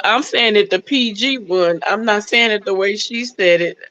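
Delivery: ordinary talk, nothing marked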